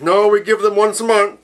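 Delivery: hint of a smug corporate rep; mock club rep. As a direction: mimic the smug club answering fans. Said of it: "It's like".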